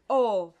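loud rounded O; teacher's rounded O; O demo. Shown as a demonstration. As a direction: round the lips into an O. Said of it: "La O se forma".

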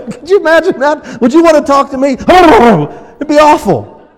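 high, laughing disbelief; preacher laughing aloud to the congregation about teasing his wife. Real, not acted. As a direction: laugh through the words.

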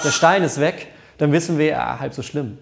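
ordinary delivery

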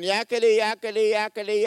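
high, loud mocking yakety; mock chatter. Preacher mocking Elijah's endless complaining to God. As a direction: rattle it off like endless nagging.

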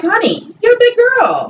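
high-pitched mock address to a girlfriend; imitated high-voiced retort; mock coaxing. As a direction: pitch it way up, as if breaking it to her. Voicing a frank retort to her.

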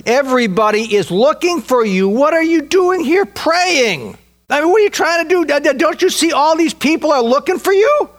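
urgent, incredulous call to Jesus; astonished exclaiming. Retold or imitated. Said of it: "they exclaimed".

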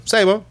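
"Sabo" run together as one quick, high word; clipped passing greeting. Shown as a demonstration. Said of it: "I cut that sentence into a quarter".

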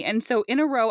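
ordinary delivery

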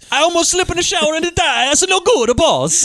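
high, hammed-up Greek accent; mock Greek accent. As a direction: exaggerate a comic Greek accent. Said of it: "Is there a Greek accent?".

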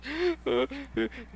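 high, shaky gasp before crying; a kid's sob catching in the throat; sobbing gasp. Retold or imitated. Boy home from school, gasping as he starts to cry. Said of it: "the floodgates pour open".

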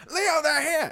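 high-pitched mock yell; imitated scream. Game master replaying the player's warning to Leo, imitated scream. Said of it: "so you scream".

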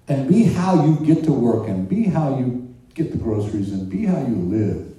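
ordinary talk

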